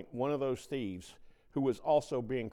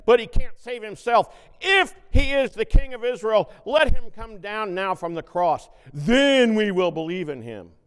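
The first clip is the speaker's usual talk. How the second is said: loud, higher scornful taunt; priests' mockery; 10 semitones higher, 15 dB louder than usual. Preacher voicing priests mocking Jesus, loud scornful taunt. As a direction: sneer it as a challenge.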